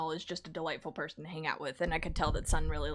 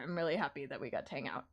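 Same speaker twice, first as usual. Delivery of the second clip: ordinary talk, nothing marked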